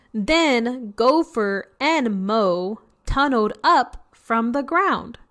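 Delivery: bright, lively picture-book reading; reading to children. A narrator's reading. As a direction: read it out to a room of kids. Teacher reads picture book aloud.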